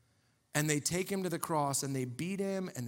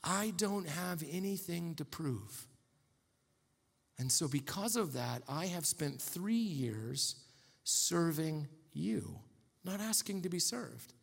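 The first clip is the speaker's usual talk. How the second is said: gentle; 3 semitones lower, 5 dB quieter, slower than usual. Retold or imitated. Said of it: "He says".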